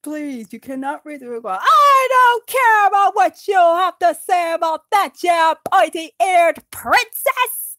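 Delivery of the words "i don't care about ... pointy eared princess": loud shouted insult, slowing at the end. Acted. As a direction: yell it at the elf. Dwarf insults elf king.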